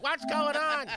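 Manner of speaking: high confused mom voice. Retold or imitated. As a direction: ask like a baffled mother. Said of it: "my mom was like".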